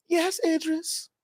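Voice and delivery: soft, slow, swooning reply in mock London English; mock-swooning woman answering her date. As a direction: melt as you say it. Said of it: "You be like".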